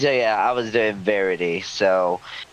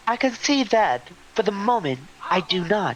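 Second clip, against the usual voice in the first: much higher, haughty character voice; 9 semitones higher than usual. Acted. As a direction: lift the voice high and proud. Voicing a character in the game.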